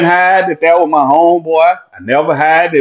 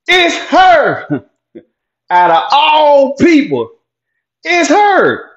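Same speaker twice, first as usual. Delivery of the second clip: disbelief; 8 semitones higher, slower than usual. Real, not acted. Shocked that she is calling.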